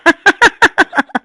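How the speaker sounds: high, rapid chuckle; real laugh. Real, not acted. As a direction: laugh it off after a mix-up. Laughs at own mistake after apology.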